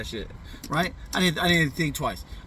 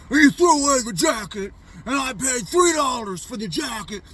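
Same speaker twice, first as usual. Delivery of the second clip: loud, higher outburst as the father; angry dad; 8 semitones higher, 4 dB louder than usual. Retold or imitated. Father scolding his son for trashing the jacket, loud and higher. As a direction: shout it like a dad who paid for that coat.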